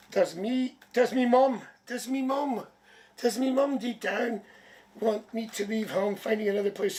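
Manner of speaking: viewer's me mom read aloud; a hint of Irish me mom. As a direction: lightly voice the me mom phrasing. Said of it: "[name], you just said, "Me mum".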